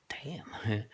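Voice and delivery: a soft, breathless whispered damn; awed whisper. Acted. Driver whispering in amazement at the talking dog. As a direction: whisper it in disbelief.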